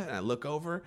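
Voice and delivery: ordinary delivery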